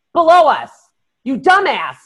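high, loud, exasperated mock yell; imitated yelling. Lady on the boat to her diving teammate, mock yelling. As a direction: yell orders like a fed-up teammate. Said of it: "she's totally yelling at him".

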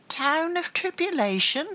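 high, muffled puzzled question. A narrator's reading. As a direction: ask it as if from inside a locker. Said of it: "the muffled voice questioned".

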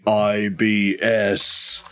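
slow booming godlike voice; magically amplified voice. Acted. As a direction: boom each letter out slowly. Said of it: "You hear a booming voice saying".

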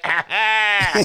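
loud high mocking ah ah; mock Kermit grunt. Kermit loudly brushing off the complaint. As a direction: blurt it loud and silly. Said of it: "And Kermit be like".

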